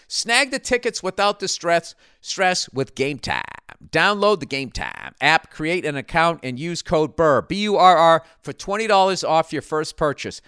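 brisk sponsor pitch, letters spelled out; lightly pushed ad voice; ad read. Acted. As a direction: pitch the app like a host read. Host reads sponsor ad.

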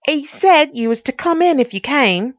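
high, loud housekeeper voice; anxious housekeeper. Acted. Housekeeper letting in a visitor, high and loud.

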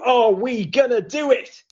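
high, hyped-up question; excited rallying cry. Real, not acted. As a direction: fire up the crowd with it. Host hypes up US chances.

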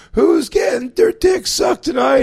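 high, slow, shouted boast to the crowd; triumphant shout. Retold or imitated. Comic on stage, mic back, shouting a high slow boast. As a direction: belt it out like a rock star reclaiming the room.

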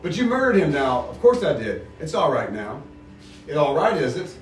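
reading a novel aloud to the group; steady read-aloud with the characters lightly voiced; story reading. To the book group, reading the chapter aloud.

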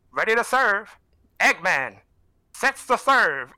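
put-on old school game announcer call; lightly voiced game announcer; video game announcer. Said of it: "this old school game, video game announcer".

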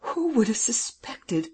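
slow, high, breathy disbelief; amazed. A narrator's reading. Man learns his attacker was a woman, amazed. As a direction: marvel in a drawn-out near whisper. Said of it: "He stared in amazement".

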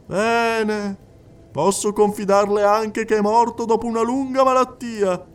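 high, insistent clairvoyant addressing the hall; a medium coaxing a silent widow; medium's voice. Acted. Medium speaking to the crowded séance hall, high and insistent.

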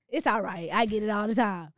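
imitating the deep-voiced woman; deep voice impression.

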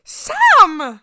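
scolding a friend over her banana bread; calling out. Real, not acted. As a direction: cut her off with a shout of her name.

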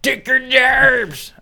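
high, slow mock outrage; mock angry yell. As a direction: ham up an angry protester. Mocking anti-robot rant.

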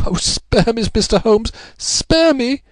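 very high, desperate begging; frenzied pleading. A narrator's reading. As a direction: beg for mercy in a high wail. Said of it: "she pleaded, in a frenzy of supplication".

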